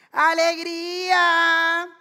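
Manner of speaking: loud hawker's call, very drawn out; long, sustained seller's shout; street vendor's cry. Acted. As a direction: call out the sweet for sale, stretching the last vowel. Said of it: "yo iba gritando".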